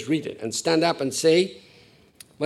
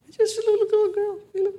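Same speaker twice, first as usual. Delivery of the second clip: put-on much higher, louder, voice of a small girl; high little girl; 6 dB louder than usual.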